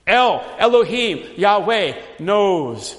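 slow, weighty proclamation of the names; emphatic names. Shown as a demonstration. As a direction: lean hard on each divine name. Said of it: "Different names for God".

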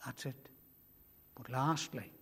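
ordinary talk, nothing marked

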